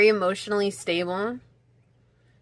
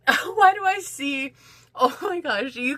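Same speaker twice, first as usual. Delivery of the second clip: high laughing squeal; giggling in disbelief at the image; 6 semitones higher than usual. Real, not acted. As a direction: laugh through the question, way up high. Laughing at her own vision.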